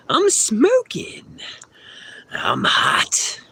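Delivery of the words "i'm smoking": squeaky joke. Pretend.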